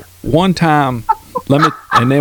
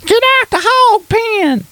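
much higher, hammed-up mountain mom hollering; hillbilly holler. Mocking a mountain mom calling kids from the hog pen. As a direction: yell it high like a hillbilly mom. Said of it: "hillbilly hollering".

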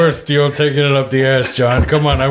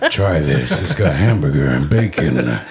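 low, slow seductive purr; sexy voice; 11 semitones lower, slower than usual. Acted. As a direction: seduce the listener with every ingredient. Continues the sexy menu read.

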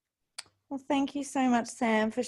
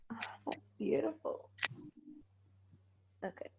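breathless whisper, barely voiced; 13 dB quieter than usual. Real, not acted. Host whispering to her guest after tearing up. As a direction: whisper it, too moved to speak up.

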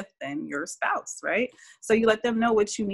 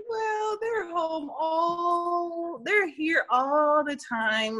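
resigned drawn-out shrug; 10 semitones higher, much slower than usual. Retold or imitated. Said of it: "it's kinda like".

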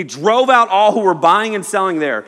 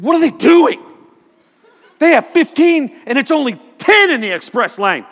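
high, loud indignant complaint; mock outrage; 7 semitones higher, 5 dB louder than usual. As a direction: act out an exasperated shopper losing it over nothing. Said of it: "People get mad about lines".

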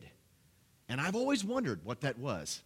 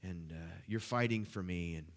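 prayer; 3 semitones lower than usual. Real, not acted. Prays before congregation.

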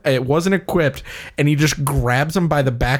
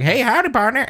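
friendly prospector; 10 semitones higher than usual. Retold or imitated. Voicing friendly prospector NPC.

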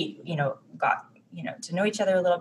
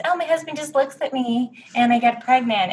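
higher-pitched mock-oblivious quote; breezy mimicry; 7 semitones higher than usual. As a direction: imitate someone's thoughtless, breezy remark in a higher voice. Mimics others' most hurtful comment.

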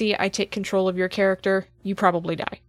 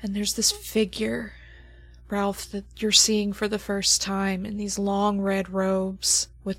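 game master scene setting; 4 dB quieter than usual. Acted. GM sets the opening scene.

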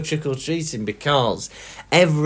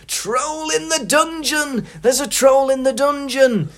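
panicked Quirrell; 11 semitones higher than usual. A narrator's reading. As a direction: shriek it like a terrified teacher. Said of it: "Quirrell comes in".

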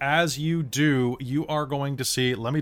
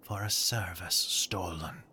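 whispering; 3 semitones lower, 6 dB quieter than usual. Acted. GM voice answers the player.

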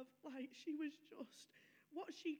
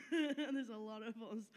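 laughing through it, a louder burst; 9 dB louder than usual. Real, not acted. Telling the church about her big family, laughing. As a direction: chuckle the words out loud.

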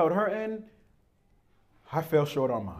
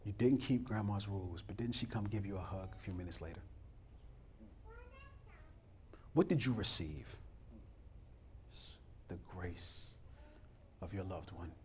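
hushed preaching; 8 semitones lower, 14 dB quieter than usual. Acted. Quiet intimate preaching.

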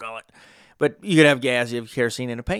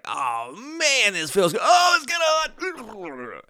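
high, blissful shouts of pleasure; stretched-out ecstatic yelps; blissed-out cow; 10 semitones higher, slower than usual. Pretend. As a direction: voice a steak cow loving the heat, way up high. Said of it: "I hope he's like".

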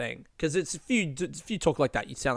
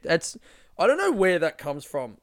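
usual speaking voice

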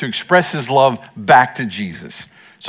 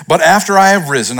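ordinary talk

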